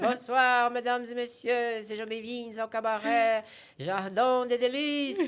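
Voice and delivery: loud, high French cabaret host; French accent. Retold or imitated. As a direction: announce it with a thick French accent. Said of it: "E eu falava com sotaque francês".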